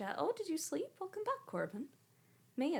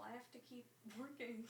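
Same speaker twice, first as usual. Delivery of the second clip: ordinary delivery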